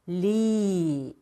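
"리" held long and slow without blocking the air; flowing ㄹ. Shown as a demonstration. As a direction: say "리" as a smooth, sustained stream. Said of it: "막지 마시고 흐르게".

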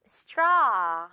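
straw said with a wide open ah; model straw. Shown as a demonstration. Teacher modeling straw for students to repeat. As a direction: say straw clearly with the mouth wide. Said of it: "One more time".

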